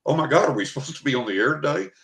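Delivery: fast and high, with a nervous laugh; retold panic. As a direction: rush the question as if the show slipped your mind. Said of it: "I was panic stricken".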